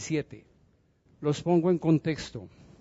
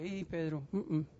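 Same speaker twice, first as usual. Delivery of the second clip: soft and reassuring, much quieter; calming Pedro; 6 dB quieter than usual. Acted. Jesus calming Pedro about his death, soft and tender. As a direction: gently settle him down.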